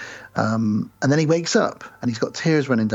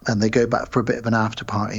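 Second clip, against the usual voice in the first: normal talking voice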